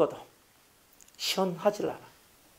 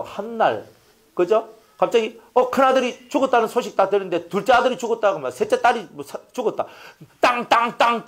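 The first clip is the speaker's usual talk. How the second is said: ordinary delivery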